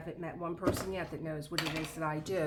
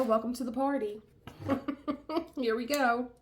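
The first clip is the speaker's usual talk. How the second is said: normal talking voice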